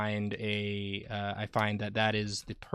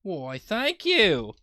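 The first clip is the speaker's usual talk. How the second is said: British orphan voice; 7 semitones higher, 5 dB louder, slower than usual. Acted. Accepting compliment in British orphan voice.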